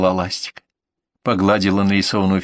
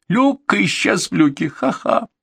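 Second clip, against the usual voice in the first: насмешка; 9 semitones higher, slower than usual. Acted. Mocking her own pun, high.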